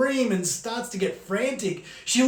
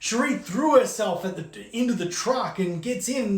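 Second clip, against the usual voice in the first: usual speaking voice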